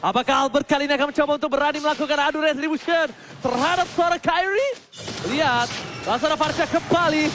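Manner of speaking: normal talking voice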